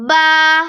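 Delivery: با said slowly with open mouth; letter name recited. Shown as a demonstration. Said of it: "با کے لیے آپ کا منہ کھلنا ضروری ہے".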